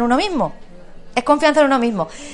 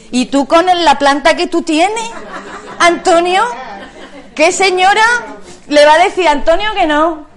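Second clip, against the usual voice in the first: laughing tease; 4 semitones higher, 5 dB louder than usual. Real, not acted. Teasing Antonio about his charm.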